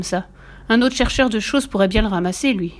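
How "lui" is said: usual speaking voice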